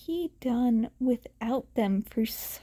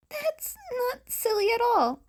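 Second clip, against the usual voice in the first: tender reassurance; 12 semitones higher than usual. Acted. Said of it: "he reassured her".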